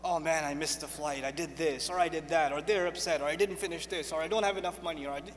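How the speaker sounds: low, soft grumbling to oneself; worrier's complaint. Acted. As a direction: run through your troubles under your voice. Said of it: "I'm constantly thinking about what didn't go right".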